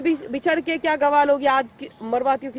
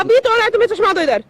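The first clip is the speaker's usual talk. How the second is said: loud, high-pitched blame; angry accusation; 8 semitones higher, 7 dB louder than usual. Real, not acted. Woman demands her broken glasses back, angrily loud. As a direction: insist loudly that he is at fault.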